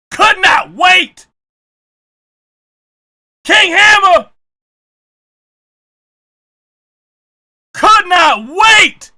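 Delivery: loud, slow, high-pitched outraged ranting; outraged shout. Real, not acted. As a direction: bellow it out in disbelief. Ranting about clip, shouting.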